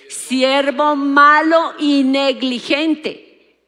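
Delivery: very slow, weighty judgment; a master condemning his lazy servant, slow and heavy; stern rebuke. Acted. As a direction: spell out each word like a verdict from the throne. Lord rebukes unfaithful servant.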